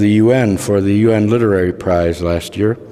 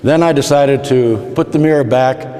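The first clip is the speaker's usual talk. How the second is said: usual speaking voice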